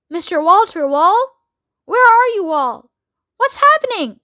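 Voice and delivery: calling into the radio, alarmed; frantic calling. A narrator's reading. President calling his lost crew.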